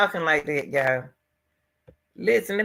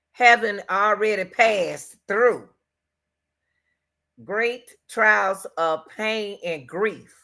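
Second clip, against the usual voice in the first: slow quote; 2 semitones higher, slower than usual. Retold or imitated. Quoting the line again slowly.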